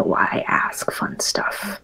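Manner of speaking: conspiratorial whisper. Acted. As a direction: whisper it like a plot. Conspiratorial aside in character.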